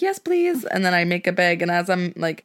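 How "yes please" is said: eager munch queen. Retold or imitated. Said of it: "She's like".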